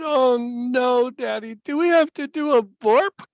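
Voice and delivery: high-pitched pleading child imitation; mock boyish dread; dismayed little boy. Voicing dismayed son pleading with father.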